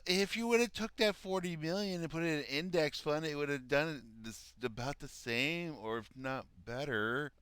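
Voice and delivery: high-pitched put-on know-it-all voice; mocking moron queen. Reactor mimicking her financial advice to a billionaire, mocking high voice. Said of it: "this internet moron queen gives financial advice".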